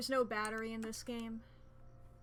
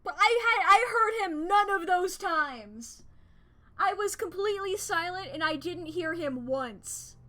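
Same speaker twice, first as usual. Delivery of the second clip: high, loud exclaiming; excited shouting; 7 semitones higher, 11 dB louder than usual. Real, not acted. Excited whisper-to-shout over game jump.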